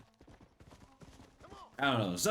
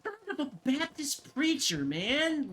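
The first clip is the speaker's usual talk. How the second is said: much higher mock exclamation; mock incredulity; slower than usual. Repeating a friend's odd swear, high mock disbelief.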